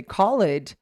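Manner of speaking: CALLED broken into call-ED; mispronounced ed. Shown as a demonstration. Teacher warns of confusion, "called" mispronounced as call-ed. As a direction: say "called" wrongly with two syllables. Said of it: "they will probably not understand it".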